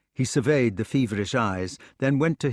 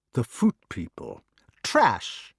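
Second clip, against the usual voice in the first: contempt; slower than usual. Acted. Mordred's contempt.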